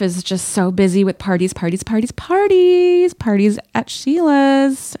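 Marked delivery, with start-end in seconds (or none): none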